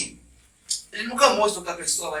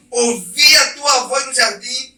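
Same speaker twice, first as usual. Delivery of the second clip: frightened Adam; 4 semitones higher, 5 dB louder than usual. Acted. Reading Adam's fearful answer.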